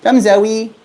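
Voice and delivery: very high squeaky pet voice; talking to a pet bird. Real, not acted. Calling bird out of cage.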